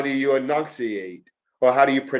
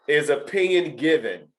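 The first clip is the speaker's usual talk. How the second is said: ordinary talk, nothing marked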